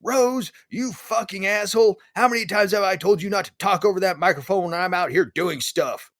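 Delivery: mock screaming director. Director yelling over the PA at the puppeteer, mock screaming. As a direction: rage at the puppeteer like a tyrant director. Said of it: "you can hear the director start screaming".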